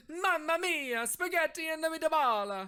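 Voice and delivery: high, loud hammed-up Italian accent; mock Italian accent. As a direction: ham it up like a cartoon Italian. Fake Italian.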